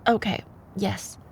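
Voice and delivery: ordinary delivery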